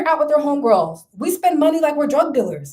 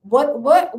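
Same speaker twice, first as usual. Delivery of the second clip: ordinary talk, nothing marked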